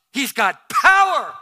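slow, loud proclaiming shout. Acted. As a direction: declare his power with a cry. Climax of sermon point.

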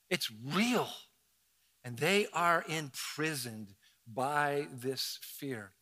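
ordinary talk

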